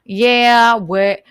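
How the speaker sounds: loud, mocking Southern accent; exaggerated dim-witted Southern accent; idiotic Southern man. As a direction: do a dopey Southern good old boy. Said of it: "Men with Southern accents sound like idiots".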